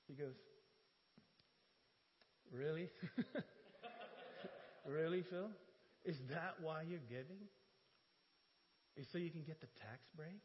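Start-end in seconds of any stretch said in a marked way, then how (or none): 2.5-10.4 mildly sarcastic questioning, usual voice. Retold or imitated. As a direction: imitate a gently sarcastic rebuke. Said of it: "my sarcasm, my tone of voice".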